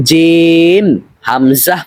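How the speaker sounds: long held "জিম", then "হামজা"; correct careful reading. Shown as a demonstration. Teacher reading the pair correctly, "জিম" drawn out. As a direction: stretch "জিম" and finish on "হামজা". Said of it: "একটু শুদ্ধ করে".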